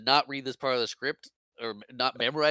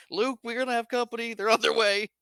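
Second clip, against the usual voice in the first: high, raised warning call; mock urgent call; 10 semitones higher, 3 dB louder than usual. Han calling to Luke that company is coming, mock urgent. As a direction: imitate calling out to a partner, pitched well up.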